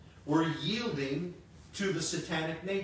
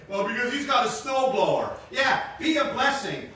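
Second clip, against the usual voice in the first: ordinary talk, nothing marked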